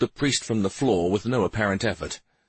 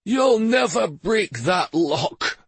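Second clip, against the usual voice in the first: high, slow, louder sputter; 11 semitones higher, 4 dB louder, slower than usual. A narrator's reading. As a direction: spit it out in fearful defiance. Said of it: "the priest sputtered".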